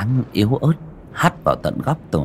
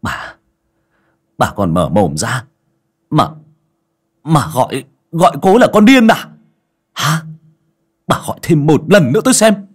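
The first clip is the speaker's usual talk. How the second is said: rising to a loud, fast furious shout; furious son; 5 semitones higher, 6 dB louder than usual. Acted. Son yells at his mother for calling his wife mad. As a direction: build from a choked start to a yell.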